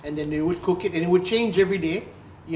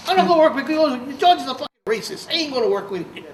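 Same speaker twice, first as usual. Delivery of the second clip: much higher, louder, building fury; rising anger; 9 semitones higher, 6 dB louder, faster than usual. Retold or imitated. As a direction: escalate the refusal, furious now. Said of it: "I got really pissed off".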